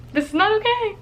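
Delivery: high-pitched mock panic; scared protest, played for fun; retold freak-out. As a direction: squeal it as if mildly panicking. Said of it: "we were freaking out".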